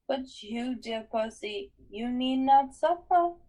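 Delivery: a touch of soothing purr; comforting purr. Acted. Medusa coaxing Percy to look up, purring. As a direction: coax him gently, like a grandmother. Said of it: "modulated back to a comforting purr".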